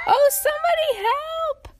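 very high, loud squeaky plea; tiny panicked shriek. A narrator's reading. Tiny cornered by grinning cat, panicked shriek. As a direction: wail for help in a tiny voice. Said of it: "at the top of her voice".

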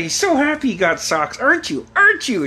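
sing-song high mock delight, climbing; imitated taunting dad. Dad taunting kid who got socks, mock gushing. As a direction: needle the kid, pitch rising each question.

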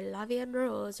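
playful French accent. Shown as a demonstration. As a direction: croon it like a cartoon Parisian. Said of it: "That was probably really offensive to French people, that accent I just did".

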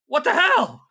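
startled NPC exclaiming; surprised character voice. Acted. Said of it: "he's like".

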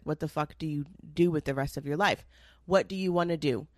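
ordinary talk, nothing marked

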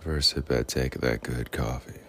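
low, smooth put-on voice; smooth, deep self-parody; smooth bling character. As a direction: slip down into the deep coffee-lover voice. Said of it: "I turn into a different bling character".

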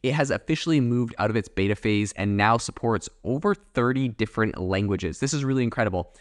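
ordinary talk, nothing marked

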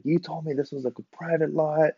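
high, held-back mock scolding; mad dad. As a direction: imitate an angry father at reduced volume. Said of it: "got so mad at him".

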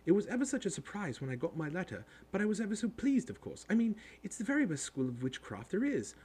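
high, rapid schoolgirl chatter; bossy girl talking in a rush; very fast. A narrator's reading. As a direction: rattle it off like an eager know-it-all. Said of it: "She said all this very fast".

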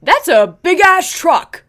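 slow, loud, high mock yell of the passing cyclist; imitating the biker. Friend imitates the bike man yelling at a truck, slow loud mock shout. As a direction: belt it out slowly like the guy on the bike shouting at the truck. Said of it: "and he goes".

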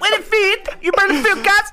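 very high, loud mock gangster voice; Top Boy audition. Actor auditioning for casting agent, squeaky hammed-up gangster voice. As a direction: overact the street slang in a squeal. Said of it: "I wanna be in Top Boy".

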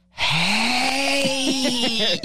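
put-on high, drawn-out drunken "hey"; drunk husband. Imitating drunk husband climbing into bed.

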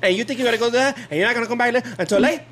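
high, fast, hammed-up scolding; mock angry wife. Husband imitating wife scolding him, high and fast. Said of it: "When she gets mad".

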